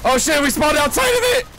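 high panicked shout. Real, not acted. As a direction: shout in alarm. Panicked in game.

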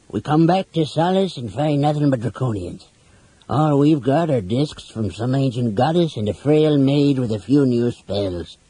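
put-on grumbler's character voice; grumbling. A narrator's reading. Flint complaining to companions about coming back to Solace, grumbling. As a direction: keep grumbling through the complaint. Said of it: "Flint grumbled".

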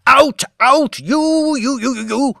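high, loud rising frenzy; frenzied shouting. A narrator's reading. Old lord in a frenzy shouts at his wife. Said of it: "He worked himself into a frenzy".

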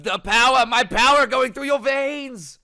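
mock-maniacal yell, stretched out; hammed-up power frenzy. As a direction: bellow it like a cartoon sorcerer possessed. Acting Eric's power frenzy.

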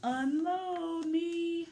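hammed-up weary truck begging. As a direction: stretch it out like a tired truck. Truck asks to be unloaded.

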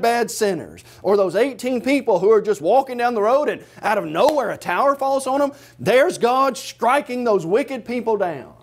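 ordinary talk, nothing marked

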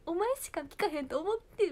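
high, loud Kansai-dialect protest; =plead as dad caught out; dad's excuse. Retold or imitated. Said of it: "パパはいまだにいじってる". (父親の必死な関西弁の言い訳)

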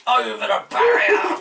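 loud gleeful cry; excited shout. Real, not acted. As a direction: shout it as the car flips. Car flies off track.

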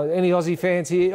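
high-pitched call to the fans; hammed-up crowd-rousing announcer. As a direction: call across a crowd of spectators. Acting announcer calling to crowd.

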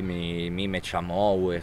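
ordinary talk, nothing marked

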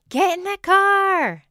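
loud, high, drawn-out yell; screaming. Acted. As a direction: scream it at the kids, stretching the last word. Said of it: "than me screaming".

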